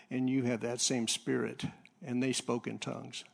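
ordinary delivery

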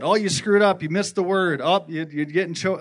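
loud, high accusing jeers; taunting devil voice. Retold or imitated. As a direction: hurl the taunts like the devil on stage. Devil accusing him on stage.

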